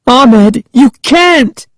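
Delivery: high, desperate plea; Sari crying out. A narrator's reading. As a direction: cry out to stop him, very high. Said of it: "Sari cried".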